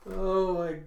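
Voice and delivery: loud, slow words through laughter; really laughing. Real, not acted. Teller cracking up at the story, real laughing. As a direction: gasp it out mid laughing fit.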